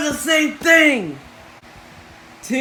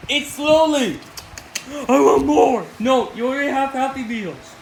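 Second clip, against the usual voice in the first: usual speaking voice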